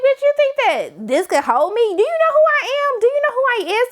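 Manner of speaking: high, loud diva taunt; sassy Michael. Pretend. Michael mocking the spell that can't hold him, high diva taunt. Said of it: "He was like".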